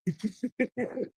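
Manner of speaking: quiet, soft chuckle; real chuckle. Real, not acted. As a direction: chuckle softly to yourself. Laughs at own church line.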